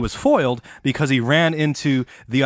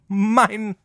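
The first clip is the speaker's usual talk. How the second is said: a sudden high, grabby cry of Mine; snatch it like a greedy goblin; childish greedy claim on the loot; 10 semitones higher than usual. Pretend. Greedy thief grabbing the relics.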